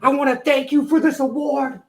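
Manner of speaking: Eddie Kingston impression. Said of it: "You know how he talk?".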